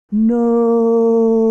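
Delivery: high, loud shrieking no. A narrator's reading. Vanilla shielding the princess with a shriek. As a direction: shriek it as you leap in front. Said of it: "shrieked Vanilla".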